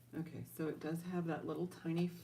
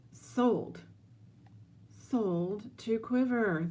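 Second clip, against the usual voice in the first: higher, louder, slow announcement; triumphant sold call; 6 semitones higher, 3 dB louder, much slower than usual. Real, not acted. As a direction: bang the gavel on it. Closing the auction.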